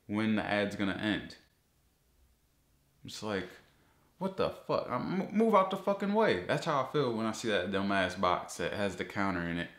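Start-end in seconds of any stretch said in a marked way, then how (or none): 4.2-6.4 high, fed-up yell; angry outburst. Real, not acted. Vlogger yelling at the countdown box, angry outburst. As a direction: snap at the box blocking the video.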